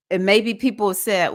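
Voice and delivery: usual speaking voice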